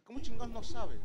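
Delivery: loud, disbelieving snap; incredulous outburst. Retold or imitated. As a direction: demand an answer, stunned. Incredulidad ante la respuesta. (incrédulo y fuerte)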